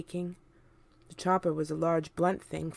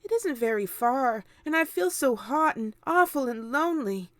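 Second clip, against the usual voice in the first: put-on a lonely child begging; James pleading; 10 semitones higher, 4 dB louder than usual. A narrator's reading. James begging his aunts, high and pleading. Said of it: "James cried out".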